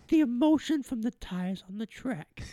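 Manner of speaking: high, slow, soft Italian caricature; mock Italian accent. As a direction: tease with a hammed-up Italian lilt. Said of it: "you got Italian".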